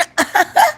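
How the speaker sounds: short, high staccato laugh; high genie cackle. Acted. Genie just woken up, high cackle.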